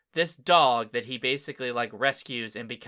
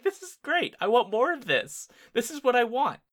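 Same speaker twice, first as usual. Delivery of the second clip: much higher-pitched, gushing excitement; retold delight; 11 semitones higher, 3 dB louder than usual. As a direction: gush as if thrilled by the scene. Retelling own delight at the scene.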